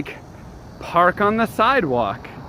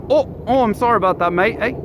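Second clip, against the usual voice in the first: higher, apologetic mock Canadian; Canadian accent; 6 semitones higher, faster than usual. Retelling a caller's apology to viewers, mock Canadian accent. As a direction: put on an exaggerated Canadian accent. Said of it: "He sounded Canadian".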